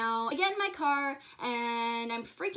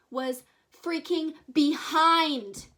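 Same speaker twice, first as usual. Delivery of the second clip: very slow, higher and louder, drawn out; dramatic slow reveal; 4 semitones higher, 7 dB louder, much slower than usual. Real, not acted. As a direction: stretch it out for suspense. Spooky reveal of shadow.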